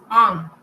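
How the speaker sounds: French nasal "on" for written EN; nasal vowel demo. Shown as a demonstration. Teacher explaining the letters E N, nasal vowel demo. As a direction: say "on" through the nose. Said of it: "Cada vez que usted vea una E N".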